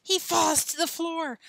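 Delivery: high, melodramatic telling; dramatic alarm. Acted. Joe play-acting Billy's collapse, dramatic alarm. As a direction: play up the mock disaster. Joe acts out mock emergency.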